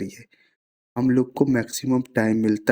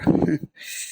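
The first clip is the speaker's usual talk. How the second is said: short breathy laugh at oneself; rueful laugh; 4 semitones higher than usual. Real, not acted. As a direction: laugh off your own slip. Laughs at own verbal slip. (अपनी गलती पर छोटी हँसी)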